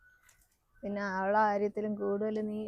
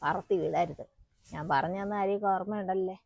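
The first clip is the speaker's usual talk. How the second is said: confiding undertone; 3 semitones lower, 6 dB quieter than usual. Acted. Mother gives secret advice softly.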